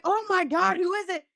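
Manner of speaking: high, gushing reenactment; mock-giddy high exclamation; imitated excitement. Acts out the excited celebrity.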